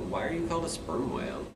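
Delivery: usual speaking voice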